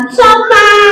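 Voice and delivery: high puppet voice; high-pitched obedient echo; puppet pupil. Acted. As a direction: parrot it high as a little puppet. Puppet repeats the modelled word.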